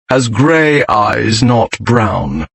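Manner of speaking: slow, bored drawl; very bored, unimpressed. A narrator's reading. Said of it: "Dane said in a very bored voice".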